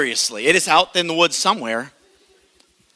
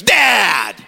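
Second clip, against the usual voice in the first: daughter calling out; 4 semitones higher, 5 dB louder than usual. Retold or imitated. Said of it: "she goes".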